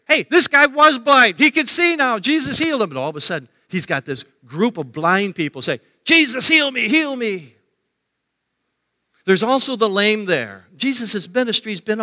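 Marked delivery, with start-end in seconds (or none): 0.1-2.9 high, loud excited announcement; excited crowd. Acted. Crowd telling the blind about a healing, excited and high. 6.0-7.6 high, insistent appeal; pleading cry. Acted. Group of blind people begging Jesus, high pleading cry.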